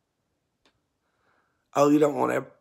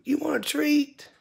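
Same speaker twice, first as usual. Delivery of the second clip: pet talk; 11 semitones higher, 5 dB louder than usual. Real, not acted. Talking sweetly to pet bird.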